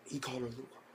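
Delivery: gasp, then hushed mock horror; soft, quick shocked gossip after a gasp; whisper-level scandalized reaction. Acts everybody's scandalized gasp.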